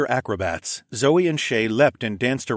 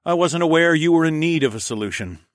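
dry; 6 semitones higher, 4 dB louder than usual. Acted. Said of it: "I said dryly".

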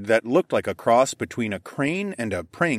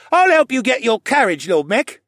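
put-on high, louder kid voice; eager boy; 13 semitones higher, 6 dB louder than usual. A narrator's reading. Stable boy offering to help the lord, high eager.

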